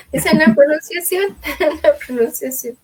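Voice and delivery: repeated through laughter; chuckling as she says it; amused laughing repeat. Real, not acted. Friend laughs repeating the point.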